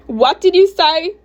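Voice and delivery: high, loud, slow challenge; affronted teacher, rising at the end; outraged demand. Acted. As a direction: demand an answer, stretching the words. Teacher confronts student's retort.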